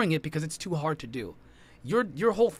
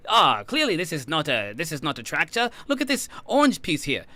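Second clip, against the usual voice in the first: mock know-it-all; 4 semitones higher, 5 dB louder than usual. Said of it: "you say".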